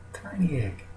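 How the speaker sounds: breathy whisper, as if not to wake it. Acted. Storyteller whispers to the audience about the tiny egg. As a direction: hush your voice over the tiny egg.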